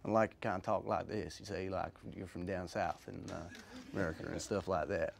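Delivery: a hint of a Southern American accent. Shown as a demonstration. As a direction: drop into a Southern US accent as a quick demo. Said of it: "which is a very odd accent".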